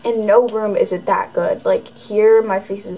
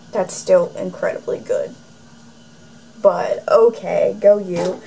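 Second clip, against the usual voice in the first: sarcastic reply; 3 semitones lower than usual. Retold or imitated. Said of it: "I'm like".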